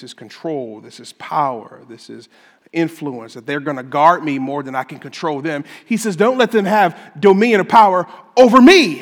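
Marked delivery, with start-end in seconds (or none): 6.2-9.0 loud, higher, forceful plea; emphatic. Retold or imitated. Preacher voicing David's plea about sin, emphatic. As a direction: punch it out with an exclamation point. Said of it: "emphatically, with an exclamation point".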